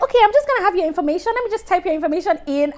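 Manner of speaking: hammed-up cashier rushing through the signup; cashier Rachel. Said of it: "she's like".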